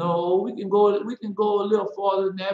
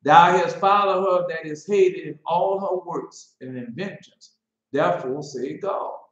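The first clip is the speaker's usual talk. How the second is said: mock biblical prophet; 2 semitones higher, 4 dB louder than usual. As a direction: declaim it like a prophet. Reading scripture aloud.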